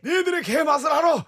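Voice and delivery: put-on a smug older man teasing; Shin Goo ad line. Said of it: "햄버거 가게 광고죠".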